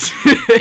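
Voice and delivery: loud high laugh. Real, not acted. Both laugh at love question.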